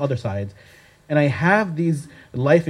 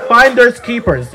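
playful taunt; 6 semitones higher, 8 dB louder, slower than usual. Retold or imitated. Friend grabs the bottle.

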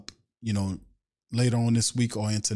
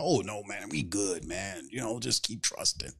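softer, easygoing brush-off of the worry; mock laid-back players; 4 semitones lower, 8 dB quieter than usual. Host mocking the Saints players who shrug off trouble, softer laid-back brush-off. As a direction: lazily wave it away, softer.

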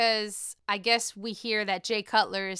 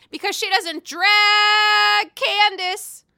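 loud, drawn-out, higher call; emphatic shout; 10 semitones higher, 9 dB louder, slower than usual. Real, not acted. Host belting out a gossip verdict to viewers, loud and slow. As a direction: stretch it out and belt the punchline.

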